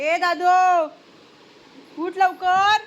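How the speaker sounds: high, loud wake-up call; shouted, insistent summons. Real, not acted. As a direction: holler to rouse a sleeper. Calls sleeper to get up.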